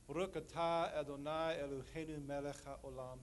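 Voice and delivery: recited Hebrew prayer; solemn recitation in Hebrew; Hebrew blessing. Real, not acted. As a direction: intone the Sabbath blessing.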